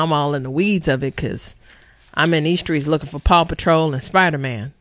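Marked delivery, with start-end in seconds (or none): none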